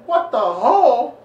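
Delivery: high, loud, drawn-out dad yell; mock outraged shout. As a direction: bellow it back in comic disbelief. Father's outraged repeat of son's words.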